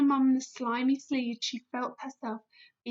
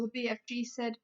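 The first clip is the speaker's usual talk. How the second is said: ordinary delivery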